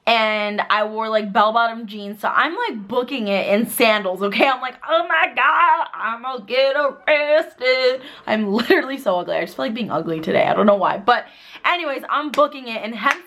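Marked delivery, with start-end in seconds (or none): 4.9-8.0 panicked wail. Retold or imitated. Fleeing police, panicking.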